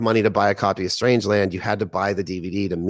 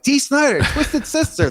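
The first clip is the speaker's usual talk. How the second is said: much higher, spelling it out; exasperated insisting. Retold or imitated. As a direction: say it like they should already know. Insisting on band name to friend.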